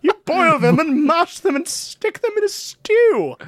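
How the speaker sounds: high, slow, laughing hobbit voice; sing-song hobbit explaining stew; Samwise impression. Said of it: "Samwise Gamgee".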